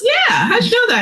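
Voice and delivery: high-pitched amazed stranger, lightly voiced; imitating the owner. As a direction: squeak it like a startled passerby. Quoting the dog owner's question.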